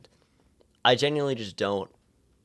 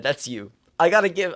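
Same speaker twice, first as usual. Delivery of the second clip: ordinary talk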